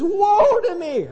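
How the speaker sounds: high, slow anguished wail; crying out in woe. Retold or imitated. Isaiah cries out before God, high anguished wail. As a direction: wail it like a man undone. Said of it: "I cried".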